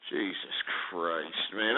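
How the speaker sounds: low, slow, drawn-out Jesus Christ; exasperated groan. Real, not acted. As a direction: sigh it out in disgust.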